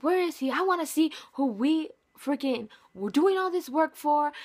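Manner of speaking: a sister's mock-furious demand; indignant demand. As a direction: demand it, fed up. Sister demanding to see him.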